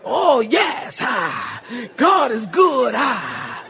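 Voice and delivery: hammed-up preacher cadence. Shopper chatting in Food Lion, mock preacher voice. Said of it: "nobody walks up".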